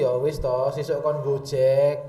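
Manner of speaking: husband staring at his phone, dismissive. Acted. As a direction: brush her off without looking up from the phone. Husband brushes off wife.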